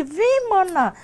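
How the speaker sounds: high, urgent beckoning; eager invitation, imitated. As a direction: call her over in a high voice, imitating the friend. Acts friend's eager invitation.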